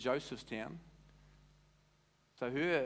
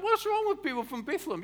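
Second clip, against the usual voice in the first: much higher, indignant outburst; exasperated disbelief; 6 dB louder than usual. Retold or imitated. Preacher retelling his outraged reaction, high indignant cry. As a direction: throw up your hands at them. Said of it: "I'm like".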